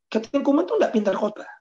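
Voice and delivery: quoting doubters with a high sneer; mocking quote. Quoting people's verdict. (kutipan mengejek dengan suara tinggi)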